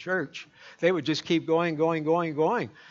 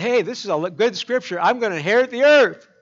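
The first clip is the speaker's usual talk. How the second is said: mock excited believer; 5 semitones higher, 7 dB louder than usual. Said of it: "you thought".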